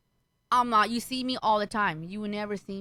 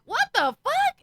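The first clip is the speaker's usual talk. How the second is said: high-pitched, louder outburst of disbelief; retold shock; 5 dB louder, slower than usual. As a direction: blurt it out in comic alarm. Retold shock at zero balance.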